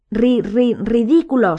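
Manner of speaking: stammered, sobbing spell. A narrator's reading. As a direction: try to cast through sobs. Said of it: "sollozaba la señora Weasley".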